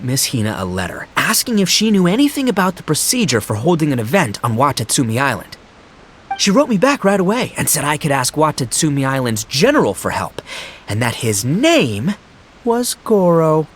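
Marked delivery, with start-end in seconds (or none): none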